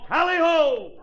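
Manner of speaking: high, loud sled-driver's cry; big hammed-up yell. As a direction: holler it out to the dog team. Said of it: "and yelled".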